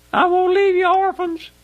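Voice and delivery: very high, straining cry; emotional promise. Retold or imitated. Christ's promise to his disciples retold, emotional high cry. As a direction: let the promise break out high with feeling.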